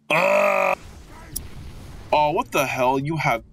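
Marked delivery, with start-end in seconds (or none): 0.1-0.8 sharp high yelp of pain; short hit yelp. Shown as a demonstration. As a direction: yelp like you just got shot. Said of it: "You just be like".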